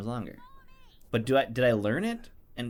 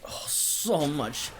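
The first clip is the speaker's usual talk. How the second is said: slow, strange put-on voice; goofy creature voice; 4 semitones higher, much slower than usual. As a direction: play a dim creature in awe. Silly pee-pee bit.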